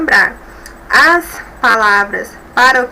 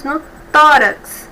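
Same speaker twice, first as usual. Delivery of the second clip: tórax with TÓ punched and louder, slow; stress on TÓ; 3 semitones higher, 6 dB louder, slower than usual. Shown as a demonstration. Teacher showing the class the stressed syllable of tórax. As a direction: land firmly on TÓ in tórax. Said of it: "tórax é a sílaba mais forte".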